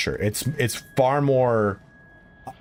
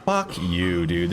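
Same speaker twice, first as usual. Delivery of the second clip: exasperated cursing; 4 semitones lower, slower than usual. Real, not acted. Streamer fed up with the game, exasperated cursing. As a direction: swear at the game in frustration.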